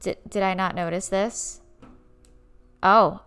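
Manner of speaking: ordinary delivery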